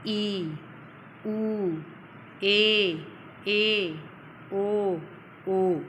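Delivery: each vowel stretched long and set apart; long vowels held. Shown as a demonstration. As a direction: hold every vowel for double length. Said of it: "हस से दुगना समय लेने वाले".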